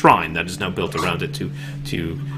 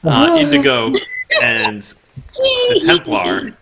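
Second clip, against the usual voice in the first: high comic narration; 6 dB louder than usual. Acted.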